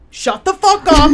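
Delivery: loud, high angry yell; retold snap. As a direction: bark it at the heckler like you've lost it. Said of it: "and, like, snapped".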